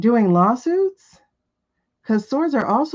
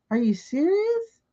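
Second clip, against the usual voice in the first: much higher, disbelieving; incredulous; 10 semitones higher, 4 dB quieter than usual. Real, not acted. Reader hearing a viewer got hacked, high incredulous. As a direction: ask in shocked disbelief. Reacting to viewer's hacking news.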